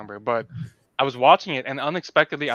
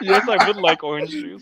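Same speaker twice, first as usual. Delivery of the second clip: mock passenger; 8 semitones higher, 7 dB louder than usual. Acting passenger ordering drink.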